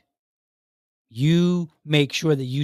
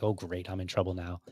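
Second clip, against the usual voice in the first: low, soft mock dread from the husband; worried husband; 6 semitones lower, 10 dB quieter than usual. Husband caught at the door, low and soft mock worry. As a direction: mumble it like a husband who knows he's in trouble. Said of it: "And he's all like".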